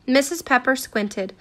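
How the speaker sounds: plain reading voice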